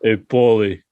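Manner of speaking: put-on thick Boston accent; Boston guy calling to a buddy. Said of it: "That was a Boston accent".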